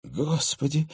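a mild frightened breath out; understated scared exhale; frightened gasp. Acted. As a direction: gasp the word in light alarm. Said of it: "испуганно выдохнула".

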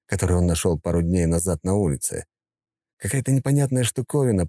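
plain reading voice

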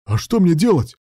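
high, loud, helpless cry; desperate exclamation. A narrator's reading. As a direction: cry out at your wits' end. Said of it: "в отчаянии воскликнул Руслан".

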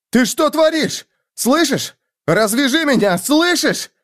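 high, frantic demand; panicked shouting. A narrator's reading. Bound man demands the girl untie him, panicked. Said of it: "охватила паника человека".

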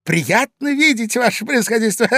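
high, giggly flattery; ingratiating laughter. A narrator's reading. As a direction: flatter nervously through chuckles. Intruder flatters with nervous laughs. (заискивающий смешок)

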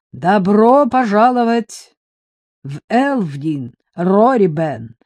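slow, booming lord's welcome; a thane's resonant greeting; deep booming voice. Acted. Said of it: "раздался глубокий гулкий голос".

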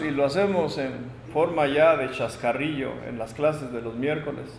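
normal talking voice

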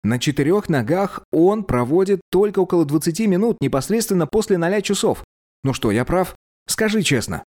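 put-on clever young man. A narrator's reading. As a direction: voice a clever young prince arguing. Narrator voices Teppic.

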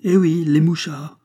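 high, loud exasperated reply; impatient insistence. A narrator's reading. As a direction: insist as if it were obvious.

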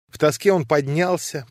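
usual speaking voice

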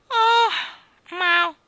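high, wailing mew; cat meow. A narrator's reading. (miagolio acuto e forte)

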